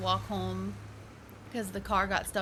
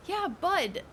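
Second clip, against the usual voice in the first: ordinary talk, nothing marked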